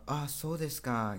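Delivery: "Ah, so desu ka?" falling at the end; falling intonation. Shown as a demonstration. Teacher answers in the sample dialogue, falling acknowledging "Ah, so desu ka?". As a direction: acknowledge it, letting "ka" drop. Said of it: "intonasinya itu menurun".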